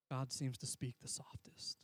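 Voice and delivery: slow whispered line. Shown as a demonstration. Pastor showing how softly God speaks, whispered. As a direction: breathe it out as a whisper.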